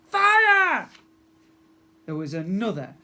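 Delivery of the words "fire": very high, very loud scratchy shout; shouted order. A narrator's reading. First mate firing the cannon, very loud high shout. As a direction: bellow the fire order.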